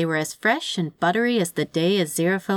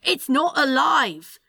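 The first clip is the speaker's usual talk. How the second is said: slow, higher, emphatic denial; exasperated insistence; 7 semitones higher, slower than usual. Acted. Demon insisting to the angel, exasperated. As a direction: spell it out in frustration. Quarrel over Roomba being alive.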